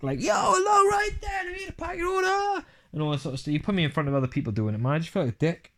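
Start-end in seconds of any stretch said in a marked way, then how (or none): 0.2-2.6 bombastic shout. Retold or imitated. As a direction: shout it like a hype host. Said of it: "I'm this bombastic".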